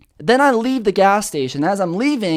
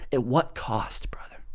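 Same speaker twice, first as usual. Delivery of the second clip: breathy whispered echo, much softer; 8 semitones lower, 11 dB quieter than usual. Acted. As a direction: whisper it as an ominous afterthought. Repeated as hushed echo.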